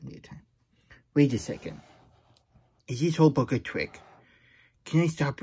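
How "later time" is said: "later time" said much softer, almost to himself; soft aside. Real, not acted. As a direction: drop "later time" to a near-silent mutter.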